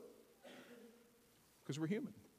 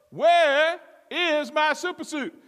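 slow, high, loud demand; Frozone shouting; 10 semitones higher, 7 dB louder, slower than usual. Acted. Husband demanding his suit from wife, slow shout. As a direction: punch out each word across the house. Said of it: "that Frozone had".

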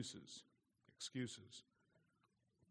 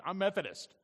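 higher, louder, snooty dismissal; haughty brush-off; 9 semitones higher, 11 dB louder than usual. Retold or imitated. Preacher imitating neighbour's snooty reply, higher and louder. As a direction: say it like you're closing the door. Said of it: "that's how they said it too".

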